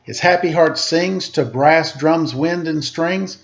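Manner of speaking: put-on lively read-aloud to children; storybook reading voice; story narration. A narrator's reading. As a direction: read it like a picture book, rhythmic. Reads picture book aloud.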